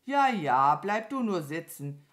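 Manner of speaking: lautes „Ja, ja“, dann ruhiger; gelassenes Abwinken. Acted. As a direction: unbeeindruckt zurückgeben. Mama Mu antwortet gelassen. (gelassen abwinkend)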